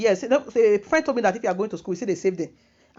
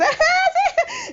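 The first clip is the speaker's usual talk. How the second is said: squealing high laugh, loud; a high squeal of a laugh at her own joke; high burst of laughter; 4 dB louder than usual. Real, not acted. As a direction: break into a high delighted laugh.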